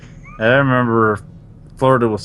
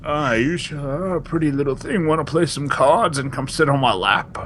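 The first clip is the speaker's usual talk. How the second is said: put-on old lecher voice, pitched up; lecherous old man; 5 semitones higher than usual. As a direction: proposition her as a dirty old grandfather. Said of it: "old enough to be your grandfather".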